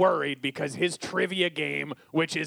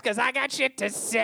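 brash defiance; 6 semitones higher than usual. Real, not acted. Defiant outburst.

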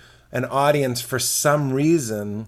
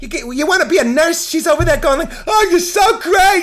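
very high, loud silly shouting; high-pitched mocking gush, shouted; Bill Burr; 6 dB louder than usual. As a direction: rant in a squealing silly voice. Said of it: "This is Bill Burr's silly voice".

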